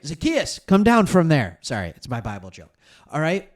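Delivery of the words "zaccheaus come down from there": loud call up into a tree. Pretend. Joking Bible call to Zaccheaus, booming loud. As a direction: shout him down like a preacher calling Zacchaeus.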